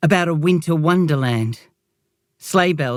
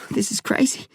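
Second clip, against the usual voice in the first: dizzy and confused; 8 semitones higher, 4 dB quieter than usual. A narrator's reading. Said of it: "dizzy now, confused, a fever chill".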